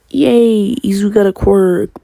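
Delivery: high, loud acted "yay" that eases off; happy cheer. Friend hearing about his quirk, happily imitated cheer. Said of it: "she's happy about it".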